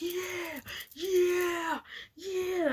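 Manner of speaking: high, whooping yeahs; whooping yells. Retold or imitated. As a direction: holler while shaking a fence. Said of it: "yelling, like, whooping".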